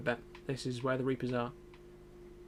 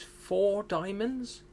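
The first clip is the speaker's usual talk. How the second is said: incredulous; 7 semitones higher, much slower than usual. Real, not acted. Drawn-out incredulous voice.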